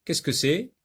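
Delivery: ordinary talk, nothing marked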